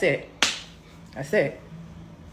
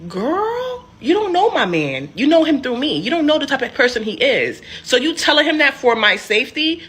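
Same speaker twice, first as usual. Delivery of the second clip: raised, higher and louder protest; indignant; 6 semitones higher, 5 dB louder than usual. Real, not acted. Scolding her sister about telling her boyfriend, higher and louder. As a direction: push back hard, pitch up.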